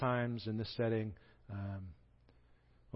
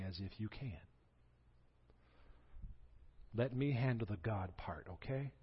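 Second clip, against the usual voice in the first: calm reassurance; 6 dB quieter than usual. Retold or imitated. God answers grumbling Elijah softly and patiently. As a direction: settle him down gently. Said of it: "my response would be".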